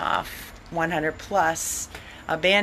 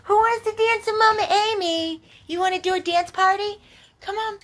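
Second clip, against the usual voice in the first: much higher, sing-song invitation to the dogs; 13 semitones higher than usual. Real, not acted. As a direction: squeal it out like calling puppies to play. Inviting dogs to dance party.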